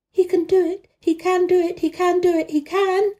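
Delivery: high-pitched, breathless-style repeated chant; urgent gabble. A narrator's reading. Girl willing the boy to stand, urgent gabble. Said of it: "she gabbled over to herself under her breath".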